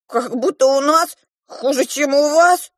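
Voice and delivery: high, loud, touchy dwarf voice; offended dwarf. A narrator's reading. The dwarf defends his home, offended. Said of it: "обиделся карлик".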